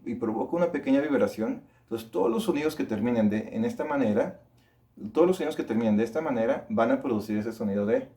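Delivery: ordinary talk, nothing marked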